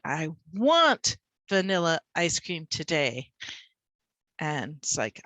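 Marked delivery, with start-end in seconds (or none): 0.0-3.3 slow line with a big punch on "want"; stress on WANT. Shown as a demonstration. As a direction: lean hard on "want", then relax. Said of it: "Okay, let's do it".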